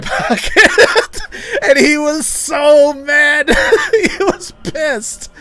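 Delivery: high-pitched laughter through the words, slow; words squeezed out between real laughs; laughing hard. Real, not acted. Telling viewers how mad the merchant got, cracking up.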